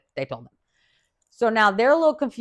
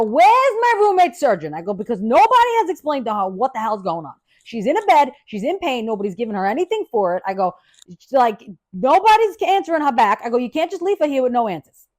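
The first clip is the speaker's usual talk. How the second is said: louder and higher, demanding answers; scolding the staff for neglecting her roommate, raised voice; angry demand; 4 semitones higher, 4 dB louder than usual. Retold or imitated.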